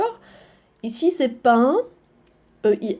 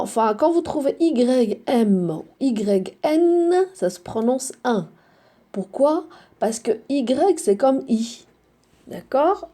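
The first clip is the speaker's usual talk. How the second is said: usual speaking voice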